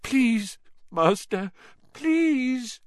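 high, whimpering begging; pitiful, high-pitched servant voice; moaned pleading. Acted. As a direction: beg your master through the pain. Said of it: "moaned Wormtail".

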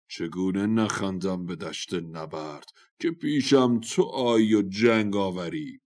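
scornful warrior's verse with a loud finish; mocking declamation. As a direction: recite the taunt grandly, drawing it out. Warrior mocks rival in verse.

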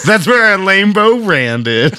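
slow, lightly voiced Southern twang; southern accent. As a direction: drag it out in a mild Southern accent. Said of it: "Leprechauns with a southern accent".

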